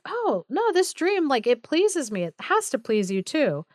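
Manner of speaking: gushing with cheerful delight. Retold or imitated. King cheers up his worried queen, delighted. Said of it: "And the king was like".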